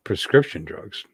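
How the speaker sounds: ordinary talk, nothing marked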